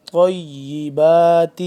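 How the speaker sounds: thick, full ط in a loud slow طیبات; heavy ta. Shown as a demonstration. Teacher shows the thick letter, slow chanted word. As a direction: make the ط of طیبات heavy, then stretch it. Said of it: "طا کو موٹا کر کے".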